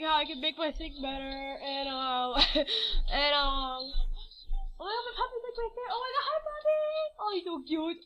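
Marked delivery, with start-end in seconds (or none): 4.8-8.0 soft, high-pitched gushing; cooing at puppy. Real, not acted. Vlogger spotting the puppy mid-video, high soft cooing. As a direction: coo excitedly in a small, high voice. Spots and greets own puppy.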